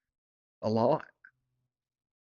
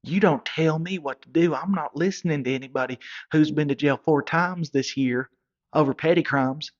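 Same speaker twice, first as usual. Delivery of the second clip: louder, higher defiant comeback; 3 semitones higher, 5 dB louder than usual. Retold or imitated. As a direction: throw it back at him hard. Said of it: "he goes".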